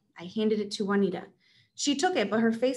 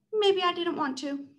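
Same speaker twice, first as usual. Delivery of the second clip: higher, teasing brush-off; Juanita evasive; 8 semitones higher than usual. Acted. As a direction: dodge the question up high.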